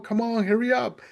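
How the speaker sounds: high, urgent call to hurry; hurried rescuer. Retold or imitated. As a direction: push him along like a captain in a rush. Rescuer urging from sinking boat.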